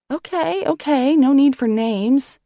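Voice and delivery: high, loud, hurried okays; flustered. A narrator's reading. Sister answering Sam, high flustered. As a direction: give in, flustered. Flustered reply while steering.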